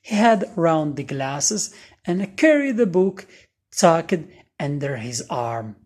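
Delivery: ordinary delivery, nothing marked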